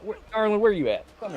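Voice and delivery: high, loud pet call. Real, not acted. Calling their cat over.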